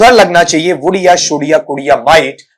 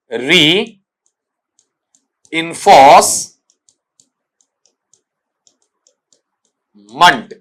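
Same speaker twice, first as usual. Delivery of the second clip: very slow "reinforcement" in three chunks, री, इंफोर्स, मेंट, with long pauses; syllable by syllable; much slower than usual. Shown as a demonstration. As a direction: break "reinforcement" apart as if dictating it, very slowly. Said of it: "इस तरीके से आएगा". ("Reinforcement" को रुक-रुक कर, बहुत धीरे टुकड़ों में बोलें)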